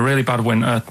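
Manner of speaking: ordinary talk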